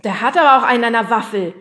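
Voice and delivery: loud, quick, indignant retort; defensive. Acted. Fred defends his fright, defensive. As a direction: protest in a rush. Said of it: "verteidigte sich Fred".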